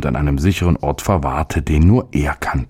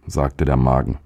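plain reading voice

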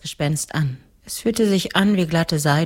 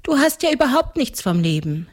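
put-on higher little-girl voice; seven-year-old girl; 6 semitones higher than usual. (kindliche Mädchenstimme)

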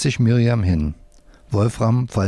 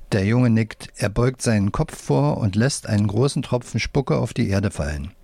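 usual speaking voice

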